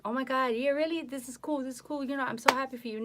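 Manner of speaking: high, loud, fast gushing; gushing excitement. Retold or imitated. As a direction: rattle off the praise, thrilled and loud. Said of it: "I was like".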